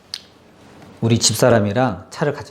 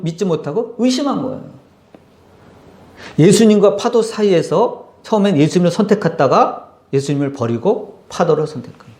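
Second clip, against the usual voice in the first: usual speaking voice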